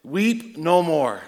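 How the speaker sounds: slow, tender promise; gentle comfort. Retold or imitated. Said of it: "Someday God's gonna say".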